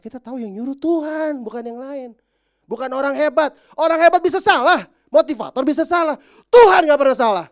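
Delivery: high, loud fiery preaching; preaching at full force. Acted. Pastor exhorting the congregation, fiery loud preaching. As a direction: preach it out with rising fire.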